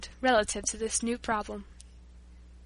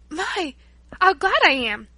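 joyful exclamation, louder and higher; glad exclamation; 5 semitones higher, 7 dB louder, slower than usual. A narrator's reading. Paula delighted at the Breton's news, exclaiming gladly. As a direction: burst out with gladness. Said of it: "exclaimed Paula".

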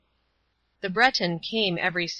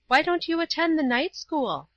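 put-on high girl's voice making a suggestion; Paula; 9 semitones higher than usual. A narrator's reading. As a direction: pitch it well up, a girl suggesting something. Young Paula voiced in story.

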